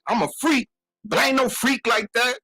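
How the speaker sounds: high-pitched mock yell at a friend; retold yelling. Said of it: "he yelling at me like".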